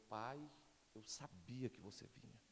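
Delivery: low, hushed, slow child's reply, retold; resposta terna imitada. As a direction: imitate a sleepy-soft reassurance to a father. Filho acordado responde ao pai. (fala mansa do filho, baixinha e lenta)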